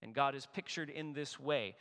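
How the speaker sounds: usual speaking voice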